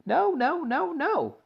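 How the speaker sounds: very high panicked protest; alarmed cry. A narrator's reading. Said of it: "cried Mister Wonka".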